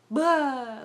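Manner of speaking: loud, high wail of tears bursting out; loud mock wail; mock burst of crying. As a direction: let out a sudden sobbing blah.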